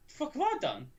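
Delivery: high, shifted voice in mock outrage; imitated high indignant retort; indignant disbelief. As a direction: squeak it out like you can't believe it. Retold indignant reply to teacher.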